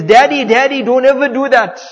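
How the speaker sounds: loud mock-pleading yell; retold scream. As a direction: imitate a boy screaming in protest. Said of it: "He started screaming".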